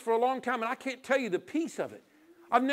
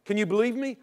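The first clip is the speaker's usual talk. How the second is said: usual speaking voice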